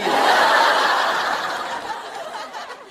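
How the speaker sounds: high, breathy burst of laughter. Real, not acted. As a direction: crack up laughing. Laughs at own impression.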